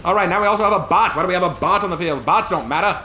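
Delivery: put-on fast, loud old-time announcer; old radio announcer. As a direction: rattle it off like vintage radio. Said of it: "Back to you".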